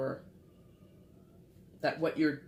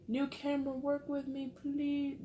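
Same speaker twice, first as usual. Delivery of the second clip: high-pitched plea to an uncooperative camera; coaxing, much higher pitch; pleading; 11 semitones higher than usual. Real, not acted. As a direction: beg it in a high little voice. Begging a camera to focus.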